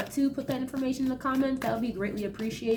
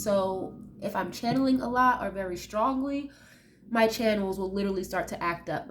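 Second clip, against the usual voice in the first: ordinary talk, nothing marked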